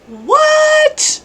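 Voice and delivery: high, drawn-out disbelief; astonished. Real, not acted. Player hearing of an odd airship, astonished. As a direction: let the pitch leap up in amazement.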